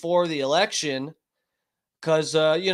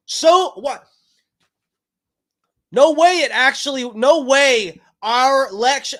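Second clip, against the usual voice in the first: screaming; 8 semitones higher, 6 dB louder than usual. Real, not acted. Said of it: "I'm sorry I'm screaming".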